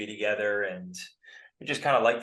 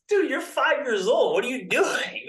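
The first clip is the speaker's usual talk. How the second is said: much higher and louder, disbelieving; can't believe a five-year-old said that; high, loud mock disbelief; incredulous; 5 dB louder than usual. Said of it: "you're just like".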